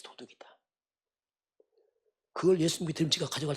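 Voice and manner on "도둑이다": 도둑이다 repeated as an unvoiced whisper; whispered echo. Shown as a demonstration. Preacher echoing that Judas was a thief, in a whisper. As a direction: breathe 도둑이다 as a hushed aside. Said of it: "해서".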